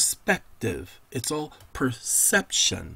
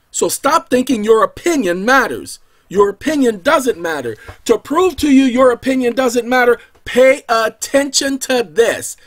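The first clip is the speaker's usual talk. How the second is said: higher and louder, driving each line home; building to a shout; 7 semitones higher, 8 dB louder than usual. Real, not acted. Preacher hammers the congregation that their opinion doesn't matter, loud. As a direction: preach it at full force.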